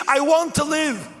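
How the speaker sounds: high, loud cry to live; screaming. Retold or imitated. Prisoner screaming to his mentor that he wants to live. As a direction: scream your will to live, slow at first. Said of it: "And I was screaming".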